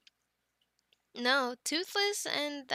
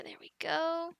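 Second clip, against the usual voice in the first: ordinary talk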